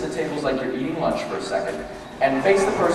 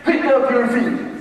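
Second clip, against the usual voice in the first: critical command; 7 semitones higher, much slower than usual. Shown as a demonstration. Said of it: "And they say".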